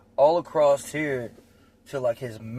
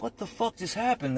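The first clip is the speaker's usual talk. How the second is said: stunned disbelief; 10 semitones higher, 4 dB quieter than usual. Retold or imitated. Bystanders react to attack.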